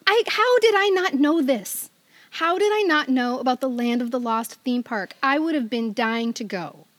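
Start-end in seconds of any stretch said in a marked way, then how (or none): none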